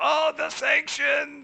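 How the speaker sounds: very high, loud, slow mock wail; cartoon soldiers' shriek. Retelling a cartoon, soldiers' shrieking high, slow mock wail. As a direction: wail it like cartoon soldiers losing their guns. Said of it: "they all go".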